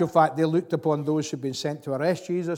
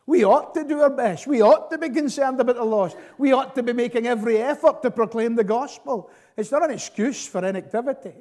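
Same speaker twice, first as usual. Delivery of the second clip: raised, much higher and louder exhortation; fervent preaching; 8 semitones higher, 5 dB louder than usual. Real, not acted. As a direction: preach it out with full force. Preacher urging the congregation.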